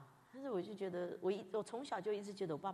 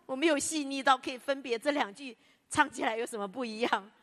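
ordinary talk

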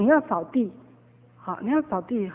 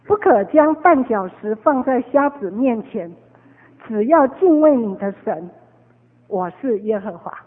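solemn scripture; 5 dB louder than usual. Acted. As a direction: read it like a solemn commandment. Reciting scripture slowly, solemn.

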